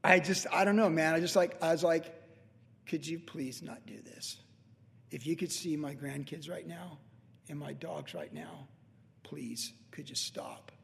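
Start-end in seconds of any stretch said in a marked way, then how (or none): 2.9-10.7 soft, slow pleading near tears; hushed, choked-up request to the neighbors; tearful plea. Retold or imitated. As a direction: beg quietly as if about to cry. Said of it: "I literally was starting to cry".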